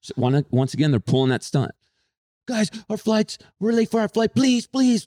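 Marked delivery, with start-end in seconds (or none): 2.5-5.0 high, whiny mock begging. Couple begging TSA line to let them cut, high whiny begging. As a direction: wheedle the crowd to let you cut. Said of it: "They're begging people to let them cut".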